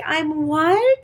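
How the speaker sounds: high, incredulous question; retold astonishment. Patient hearing she is pregnant, acted disbelief. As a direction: act stunned, voice shooting up. Stunned reply to pregnancy news.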